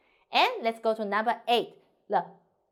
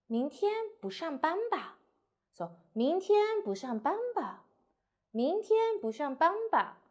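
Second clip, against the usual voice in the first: higher start, 吧 goes down; confirming question, falling; 7 semitones higher than usual. Shown as a demonstration. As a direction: check a guess with a friend. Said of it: "you need to go down".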